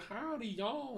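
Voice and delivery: high-pitched mock Southern drawl; mock southern accent. Mocking girls' southern accent joke, high hillbilly twang. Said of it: "used to do a southern accent joke".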